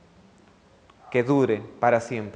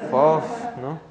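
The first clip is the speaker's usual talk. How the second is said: mock disgust puff. Mimes smelling onion breath.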